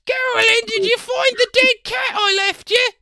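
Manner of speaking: high, loud comic character shout. Acted. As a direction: yell it in a silly voice.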